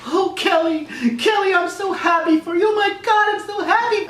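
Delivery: very high excited congratulations; gushing friends. Pretend. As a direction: squeal your congratulations to Kelly. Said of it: "fans and all their friends are probably like".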